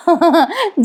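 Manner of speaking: short high laugh; light uncontrolled-sounding chuckle; giggle. Acted. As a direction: giggle as if unable to hold it in. Narrator laughs as husband cannot stop.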